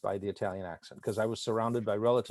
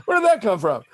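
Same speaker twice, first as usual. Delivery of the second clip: loud, high-pitched bafflement; comic disbelief, voice pushed up; mock astonishment; 9 semitones higher, 8 dB louder than usual. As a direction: exclaim it like a stunned onlooker. Acts out astonished listener's reaction.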